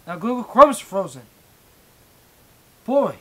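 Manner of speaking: real exasperated shout; exasperated yell. Real, not acted. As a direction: yell at the frozen computer. Browser freezes mid-stream.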